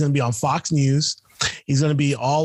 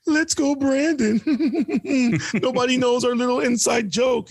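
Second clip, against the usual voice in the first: mocking taunt; 10 semitones higher than usual. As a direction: sing it out like a cutesy inside-joke taunt. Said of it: "but it's like".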